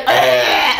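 loud fake vomiting noise; mock retching. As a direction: act out throwing up. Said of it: "and I throw up on camera".

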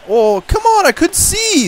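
annoyed gamer yelp; frustrated outburst. Real, not acted. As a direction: vent at the blocked view. Angry at scoreboard blocking view.

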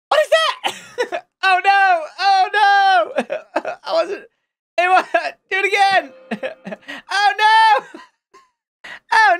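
high wailing through helpless laughter; squealed, drawn-out cries broken by laughs; laughing dismay. Real, not acted. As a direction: wail in disbelief while cracking up. Dismayed laughing at a bad enchantment.

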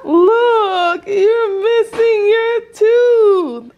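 high, loud and thrilled; bursting with excitement at a first lost tooth; excited. Real, not acted. Mom sees daughter's lost tooth.

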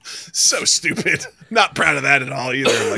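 ordinary delivery

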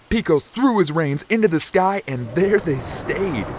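normal talking voice

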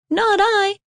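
high, tiny mouse voice; squeaky mouse. A narrator's reading. Mouse refusing the hen, high and squeaky. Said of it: "said the mouse".

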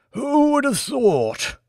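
high, slow frail mumble; mumbled. Acted. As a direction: mumble it slowly like an old lord. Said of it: "mumbled the Lord".

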